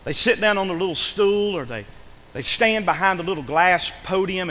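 ordinary talk, nothing marked

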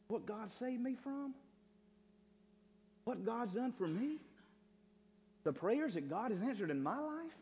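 high, suspended phrases, each left hanging; preaching testimony. Acted.

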